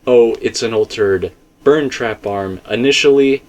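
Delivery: ordinary talk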